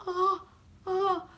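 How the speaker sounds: high mock whimpers; short, high fake sobs; imitated fangirl crying. Imitating the chorus of fangirls in the theater, crying. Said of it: "chorus of fangirls started to cry".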